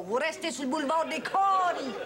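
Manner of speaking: « Caries » dit à la québécoise, « des Kories »; accent québécois. Shown as a demonstration. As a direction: prononce « Caries » avec le a grave québécois. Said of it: "Avec l'accent, c'est la deuxième syllabe". (« des Caries » à la québécoise, le a assombri en o)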